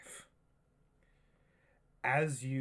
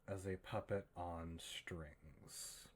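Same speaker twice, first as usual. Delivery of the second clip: whisper; 2 semitones lower, 7 dB quieter, slower than usual. A narrator's reading. GM builds creepy possession scene.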